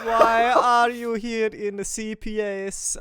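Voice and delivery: put-on lazy version of the squeaky kid voice; half-hearted high voice. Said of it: "this voice should be probably a higher, but I cannot be fucked".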